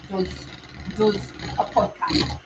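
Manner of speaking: put-on practising a Scottish accent softly. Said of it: "keeps his thick Scottish accent".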